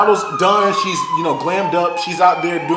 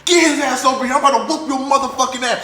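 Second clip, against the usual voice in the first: higher-pitched mock furious threat; fired-up threat, told with a grin; retold angry threat; 8 semitones higher, faster than usual. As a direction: replay your rage at the drunk guy. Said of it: "I was like".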